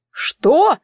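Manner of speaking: high, sharp jump of surprise; startled. A narrator's reading. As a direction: jolt upward in pitch on a startled question. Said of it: "подскочил эльф".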